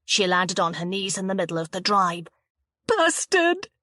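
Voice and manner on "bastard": high, sobbing cry of "Bastard"; sobbed insult. A narrator's reading. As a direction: fling the insult through tears. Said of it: "she sobbed".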